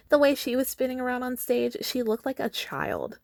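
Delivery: mock her like a child; shrill catty girl. District four girl mocking Katniss, lightly shrill. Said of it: "She's got a shrill voice".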